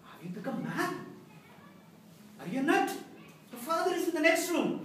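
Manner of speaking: high, loud disbelief; acted outburst of a shocked mother; aghast, shocked. Mom correcting her mistaken son, aghast. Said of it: "Aghast, shocked".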